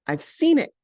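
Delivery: high excited call. Acted. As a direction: call it out with big news. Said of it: "he called".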